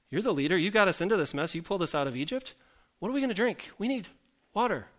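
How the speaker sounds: acted-out whiny protest; grumbling complaint. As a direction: grumble at the leader, pitch swinging up. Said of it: "they grumble against Moses".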